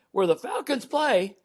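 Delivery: high, slow disbelieving panic; panicked squeak. Retold or imitated. Speaker retells the booking call, high panicked disbelief. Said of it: "I'm gonna try to mimic my response".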